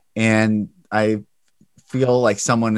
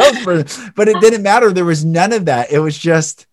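excited; 7 semitones higher, 7 dB louder than usual. Real, not acted. Emotional high point.

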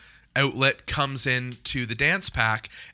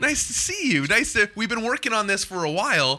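upbeat host voice; 5 semitones higher than usual. Acted. Continues hosting in upbeat voice.

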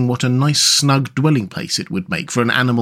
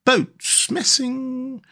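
dreamy; 12 semitones higher, much slower than usual. A narrator's reading. Rat rowing absent-mindedly, dreamy. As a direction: trail away in a reverie. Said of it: "he went on dreamily".

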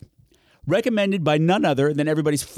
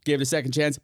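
normal talking voice

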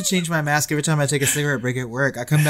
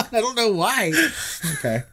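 normal talking voice

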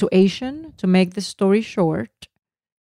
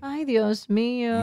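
soft, slow, higher-pitched echo; echoed lament; 4 semitones higher, 8 dB quieter, slower than usual. Real, not acted. Wife echoes husband's exclamation, soft drawn-out lament. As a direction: echo the lament softly and slowly.

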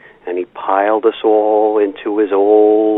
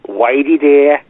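lightly voiced little boy, higher and louder; little brother calling; 6 semitones higher, 5 dB louder, slower than usual. Little brother asks at Whitey Ford's door, lightly mimicked kid's call.

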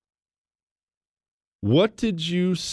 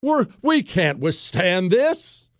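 mock complainer; 7 semitones higher, slower than usual. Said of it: "those same people across America".

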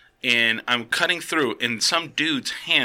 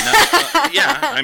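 laughing; 8 dB louder, slower than usual. Real, not acted. Teased, laughs while answering.

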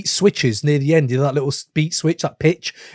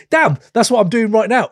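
retold jolt of recognition, higher pitch; surprised realization; 7 semitones higher, 4 dB louder than usual. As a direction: act out a startled "that's me" moment. Retold reaction to hearing a lyric.

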